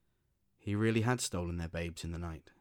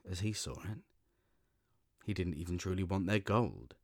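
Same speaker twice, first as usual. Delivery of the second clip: usual speaking voice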